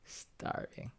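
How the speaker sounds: whispered starving; whispered to himself. Real, not acted. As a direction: breathe the word out without voice. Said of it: "I'm starving".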